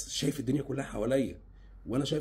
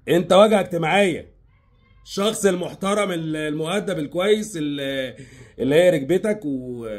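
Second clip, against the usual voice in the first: scornful mockery; 5 semitones higher, 8 dB louder than usual. Mocking him as respectable front.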